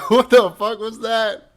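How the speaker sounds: laughing in disbelief; cracking up mid-question. Real, not acted. Reacts to partner's strange outburst.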